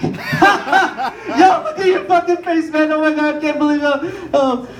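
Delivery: high, slow, cackling mockery; laughing taunt. Son laughing at his father's stony face, cackling taunt. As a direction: crack up while teasing him.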